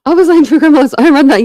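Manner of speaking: high, loud, laughing excitement; excited laughing. Real, not acted. Streamer laughs at a viewer's comment, high and loud. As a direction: bubble over with laughter.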